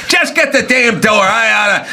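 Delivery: high hammed-up snap; mock gruff order. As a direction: bark like an old-movie tough. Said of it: "acted radio scene".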